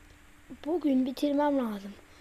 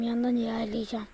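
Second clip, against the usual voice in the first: usual speaking voice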